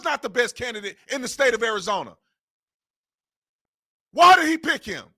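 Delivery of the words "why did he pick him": higher and louder, demanding an answer; real fed-up outburst; exasperated shout. Real, not acted. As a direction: fling the question out in disbelief. Angry question about bad candidate.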